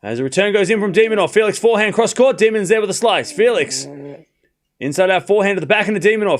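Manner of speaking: fast live commentary of the rally; play-by-play. Acted. Streamer commentating a tennis rally for chat, fast play-by-play. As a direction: call every shot as it happens.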